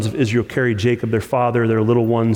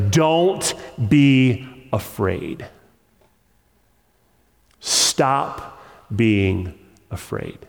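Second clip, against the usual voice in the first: slow tender reassurance; 2 semitones lower, much slower than usual. Acted. Preacher voicing God's comfort.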